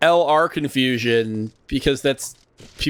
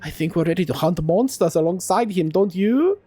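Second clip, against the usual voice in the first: put-on high-pitched kid insisting on being grown-up; swooping accented child character; indignant young adventurer; 5 semitones higher than usual. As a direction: protest like an eager youngster. Youngster protests to Mister Boulder.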